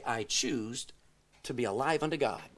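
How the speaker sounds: soft, firm private vow; quiet resolve. Pretend. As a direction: say it softly, as a promise to yourself.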